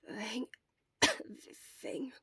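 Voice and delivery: cough, then a hoarse breathy word; coughing weakly. Acted. Sick boy in bed coughs before croaking a word.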